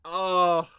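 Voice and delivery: high, loud "oh" of being moved; touched groan. Real, not acted. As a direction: wail it, heart melting. Moved by the scene.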